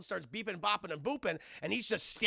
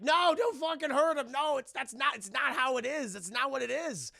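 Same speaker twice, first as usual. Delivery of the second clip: higher, louder mock-frantic protest; distressed pleading; 7 semitones higher, 6 dB louder than usual. Voicing the female gargoyle stopping a fight, frantic plea. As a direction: plead urgently as the alarmed gargoyle.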